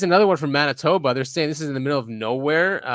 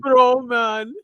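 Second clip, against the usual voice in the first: higher "bro, man" in a put-on Canadian voice; mock Canadian; 9 semitones higher than usual. Laughing at the cameraman by repeating him in a mock Canadian accent. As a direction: parrot the guy from the clip. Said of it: "He said".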